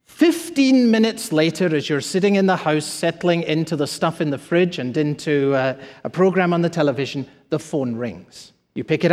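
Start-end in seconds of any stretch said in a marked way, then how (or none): none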